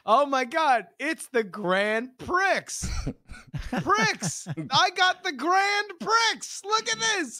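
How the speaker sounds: high, loud, gleeful boasting; excited child. Retold or imitated. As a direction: show off a new toy like an overjoyed kid. Excited child showing off toy.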